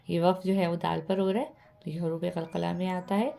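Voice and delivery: normal talking voice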